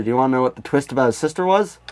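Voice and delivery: ordinary talk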